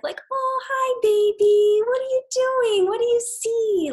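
put-on very high, sing-song voice cooing to a baby; high-pitched mommy voice, slowing down at the end; motherese baby talk. Shown as a demonstration. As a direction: coo at an infant, stretching the words. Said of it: "Your natural kind of".

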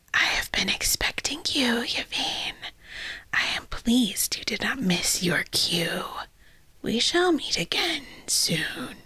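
slow whispered villain voice; booming echoing villain monologue. Acted. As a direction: hiss it like a gloating lich.

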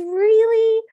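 high, loud, hopeful question; imitated delighted surprise. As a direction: act out a thrilled reaction. Cinderella delighted by prince's answer.

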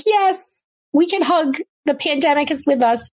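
high, gushing retold agreement; retold eager yes.

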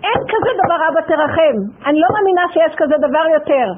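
loud high protest; reliving an angry outburst in the car; retold yelling. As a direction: shout it back in disbelief. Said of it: "ואז התחלתי לצעוק".

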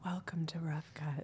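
hushed whispered welcome; mock ASMR whisper. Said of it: "dulcet, sensual tones".

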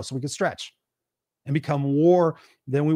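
ordinary talk, nothing marked

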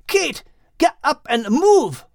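high, slow character shout; urgent call to move. A narrator's reading. Max shouting at Kate to get up.

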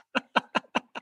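breathy snorting laugh. Real, not acted. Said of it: "did I just snort".

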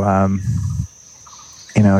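ordinary delivery

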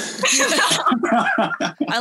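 slow words through laughter; chuckling as the joke is told; laughing while talking. Real, not acted.

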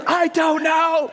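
high, slow, drawn-out yell back; exasperated daughter. Retold or imitated. Daughter yelling back at frantic dad, imitated exasperation. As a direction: imitate a child hollering each word.

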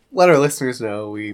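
ordinary talk

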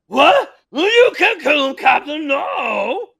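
high, loud horrified shout; shouted protest. Acted. Albert yelling at the captain to spare his brother, shouted protest. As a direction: cry out in outrage at the captain.

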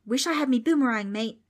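put-on high-pitched Australian boy; Australian holler. A narrator's reading. Darby wishing for boomerang, Australian holler. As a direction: holler in a broad Australian accent. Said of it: "Darby hollered".

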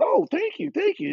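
very high, eager thank-you; overwhelmed and grateful; gushing thanks. Retold or imitated. Thanking owner, retold.

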